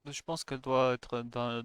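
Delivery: normal talking voice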